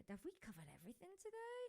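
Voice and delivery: very high, squeaky self-questioning; playful wondering. Real, not acted. Host checking the show's topics near its end, playful high wondering. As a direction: pitch the question way up in playful doubt.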